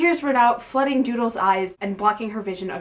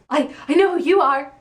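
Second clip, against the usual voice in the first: higher, louder nervous stutter; nervously; 7 semitones higher, 6 dB louder than usual. Acted. Star-struck girl telling Zane she knows him, flustered. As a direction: trip over the first word, flustered. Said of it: "Doodle added nervously".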